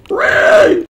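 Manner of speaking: high, loud shrieked piglin cry; piglin noise. Retold or imitated. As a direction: squeal it like a game mob. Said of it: "They're like".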